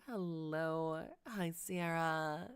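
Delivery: usual speaking voice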